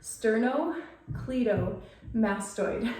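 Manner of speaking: very slow, word split into parts; drawn-out teaching demonstration of a long term. Shown as a demonstration. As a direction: say it slowly, piece by piece, for learners. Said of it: "let me say it slower".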